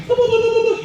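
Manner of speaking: high, even machine beeps; imitated truck beeping. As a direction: mimic a ladder truck's high-pitched beeping. Imitates the ladder truck going up.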